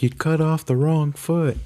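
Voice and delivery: high, drawn-out mock exclamation; quoted movie character. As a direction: imitate the character, stretched out and high-pitched. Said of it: "He's like".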